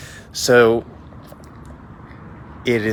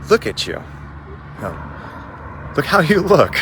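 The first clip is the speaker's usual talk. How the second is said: loud, much higher mocking monk; monk mocking the unshaved samurai, loud and high; 8 semitones higher, 6 dB louder, slower than usual. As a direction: sneer at him with relish. Monk taunting the scruffy samurai.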